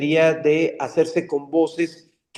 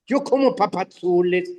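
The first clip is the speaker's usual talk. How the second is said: put-on higher, sing-song Yucatecan accent; 8 semitones higher than usual. Dubbing host imitates Cucho's Yucatecan accent. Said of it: "habla como yucateco".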